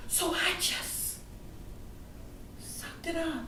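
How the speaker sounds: high, soft, slow and halting; choking back tears. Acted. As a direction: force the sob down, voice nearly gone. Child swallows the pain again.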